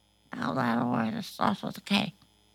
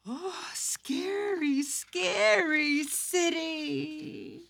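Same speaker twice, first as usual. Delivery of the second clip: mock scared; 6 semitones higher, 6 dB quieter, much slower than usual. Reading a scared page.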